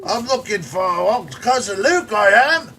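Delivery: high, loud hammed-up Australian accent; Australian caricature. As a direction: play a cartoon Aussie wrestler. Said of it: "they sadly had to play it like a caricature".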